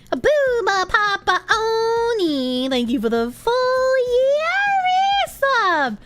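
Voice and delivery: high, slow sing-song thank-you; high drawn-out thanks. Acted. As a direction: stretch it out up high like a cartoon.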